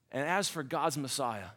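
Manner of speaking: normal talking voice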